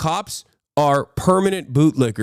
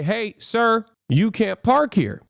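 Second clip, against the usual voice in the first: cop voice; 8 semitones higher than usual. Retold or imitated. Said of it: "They say".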